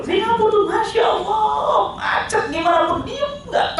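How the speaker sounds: high, loud, stretched "Masya Allah"; amazed exclaim. Real, not acted. Preacher praising Singapore traffic to listeners, amazed shout. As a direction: marvel out loud at the calm traffic.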